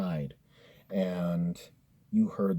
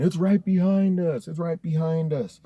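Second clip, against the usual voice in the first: retold panicked warning, pitched high; mock-frantic heads-up to a friend while fleeing; urgent alarm; 8 semitones higher, 5 dB louder than usual. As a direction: call out an alarmed warning, higher than usual. Retold friend's alarm during a chase.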